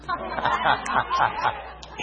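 unvoiced, wheezy burst of laughter; real laugh. Real, not acted. Preacher laughing while praying over Ron, real laugh. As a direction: laugh on the breath.

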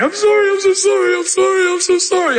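high, pleading, tearful I'm sorry; wife's tearful apology. Retold or imitated. Wife runs to husband, sorry out of her mind: high tearful pleading. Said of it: "sorry out of her mind".

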